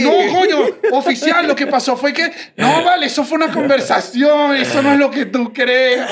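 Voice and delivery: high-pitched pleading excuses; flustered backpedalling. Retold or imitated. Retelling excuses to the officer once rattled, flustered. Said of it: "me desestabilizó la investigación".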